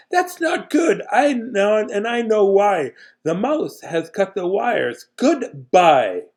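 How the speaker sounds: high, slow worried caller. Acted. Caller explains the mouse cut the wires, high and worried. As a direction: fret over the cut wires. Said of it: "Let's do a voice for that person".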